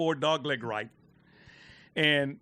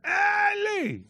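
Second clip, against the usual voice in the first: high yelled call across the green; heckler's scream. Retold or imitated. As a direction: bellow it like a heckler in the crowd. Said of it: "just screams out".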